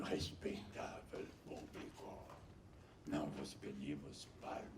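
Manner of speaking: slow, airy whisper; light whisper. Retold or imitated. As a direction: breathe the line lightly, like an actress. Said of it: "Ela falava de uma leveza". (sussurro leve e lento)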